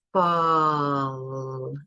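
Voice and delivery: "Fall" with every sound stretched out; "Fall" held long, no break between sounds; lengthened continuants. Shown as a demonstration. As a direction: draw out each sound of "Fall". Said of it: "You can see how I can lengthen every single sound".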